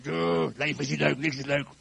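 sullen acted moan ending in a long groan; grumpy. Shown as a demonstration. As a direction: moan as if fed up with everything. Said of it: "hoe vis je nou zagrijnig?".

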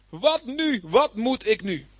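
high, drawn-out helpless cry; mildly acted panic, pitched high. Ruben at a loss before his brothers, crying out. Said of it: "riep hij".

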